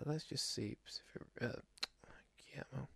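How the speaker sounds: very soft, half-whispered mumble; muttering to himself. Real, not acted. Podcast host checking a director's film list, muttering to himself. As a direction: read under your breath while searching.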